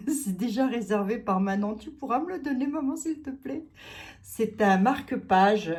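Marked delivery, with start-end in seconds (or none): 1.7-3.6 higher, lightly voiced little girl's plea; a hint of a pleading child; child asking mum. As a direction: ask sweetly like a small child.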